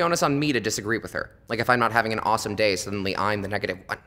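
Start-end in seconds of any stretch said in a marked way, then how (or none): none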